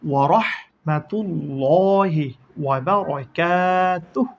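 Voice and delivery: রহমাতুল্লাহি drawn out slowly and clearly; slow recitation. Shown as a demonstration. Teacher joining spelled syllables into the full phrase, slow. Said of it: "full word after spelling".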